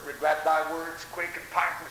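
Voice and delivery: ordinary talk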